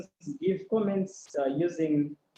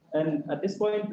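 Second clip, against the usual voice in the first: ordinary talk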